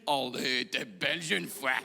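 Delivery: slow, exaggerated Belgian accent; mock Belgian accent. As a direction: do a Frenchman's clumsy imitation of a Belgian accent. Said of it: "ce genre de Français qui se sent systématiquement obligé". (faux accent belge, lent et appuyé)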